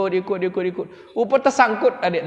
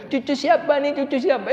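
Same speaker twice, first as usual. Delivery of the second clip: cooing grandma; 8 semitones higher than usual. Retold or imitated. Imitating village grandmothers cooing.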